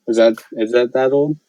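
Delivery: normal talking voice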